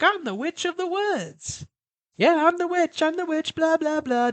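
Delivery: put-on high child's singsong voice; high playful little girl voice; little girl playing witch. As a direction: sing it like a kid at play. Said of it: "the little girl runs up and she's like".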